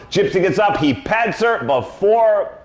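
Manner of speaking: usual speaking voice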